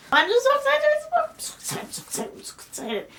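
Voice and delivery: high squeal fading to a whisper; squeal, then run out of voice from excitement; giddy squeal into whisper. Real, not acted. Gushing to viewers about the book, squeal fading to whisper.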